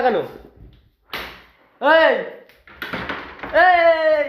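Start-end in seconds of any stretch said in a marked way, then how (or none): 1.8-4.2 sharp loud call to stop her. Real, not acted. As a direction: call out to get her attention. Calling out to the sister.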